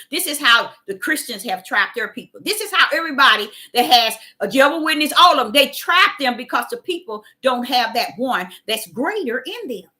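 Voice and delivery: ordinary delivery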